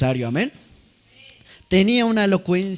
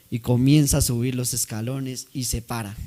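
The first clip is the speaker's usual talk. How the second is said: ordinary delivery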